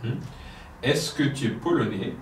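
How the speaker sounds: ordinary delivery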